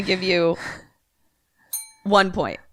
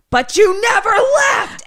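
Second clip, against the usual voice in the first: high, loud sudden scream; a neighbor's jump-scare yell; menacing shout; 7 dB louder than usual. Acted. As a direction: snap from calm into a shrieking attack. Neighbor attacks with bottle.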